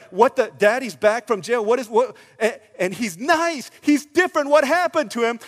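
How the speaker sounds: loud, high, stammering disbelief; acted astonishment. Jailer's children seeing Daddy changed, astonished. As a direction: play the baffled, amazed child.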